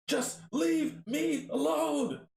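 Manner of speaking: high, drawn-out shout; desperate shout. Acted. Arthur drives off the taunting hands, desperate shout. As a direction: shout every word slowly.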